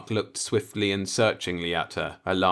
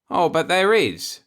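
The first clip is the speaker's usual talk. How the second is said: serious; 11 semitones higher, 7 dB louder than usual. A narrator's reading. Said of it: "he replied seriously".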